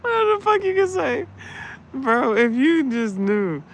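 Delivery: high, loud through laughter; laughing. Real, not acted. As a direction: crack up while talking. Laughing at own bit.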